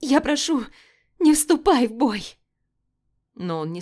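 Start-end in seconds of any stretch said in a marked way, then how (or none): 0.0-2.4 high, loud plea through tears; tearful pleading. Acted. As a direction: beg while crying. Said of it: "Слёзы текли по моему лицу".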